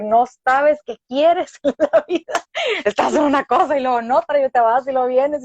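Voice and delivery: mock-scolding mom voice, exasperated; imitating her mother. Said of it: "mi mamá va a decir". (imitando a su mamá regañándola, aguda y fuerte)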